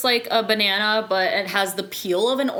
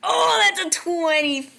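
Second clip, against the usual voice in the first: real excitement, pitched way up; excited exclamation; 9 semitones higher than usual. Real, not acted. Player celebrates a big dice roll, excited.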